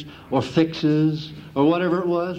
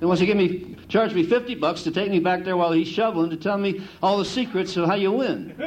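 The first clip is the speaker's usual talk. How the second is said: normal talking voice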